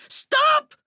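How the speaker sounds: high panicked scream. Acted. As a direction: shriek it in terror. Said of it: "Walter screamed".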